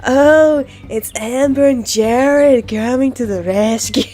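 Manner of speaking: slow, chill hippie voice; lazy laid-back hippie drawl. Acted. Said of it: "you like my hippie voice".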